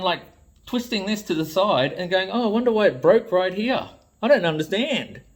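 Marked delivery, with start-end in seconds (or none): 2.3-5.2 high, loud, hammed-up innocent confusion; mock clueless voice. Mocking a buyer who twisted his own toy, feigned innocence. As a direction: play dumb, wide-eyed and loud.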